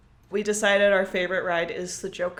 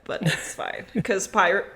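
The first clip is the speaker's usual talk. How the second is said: normal talking voice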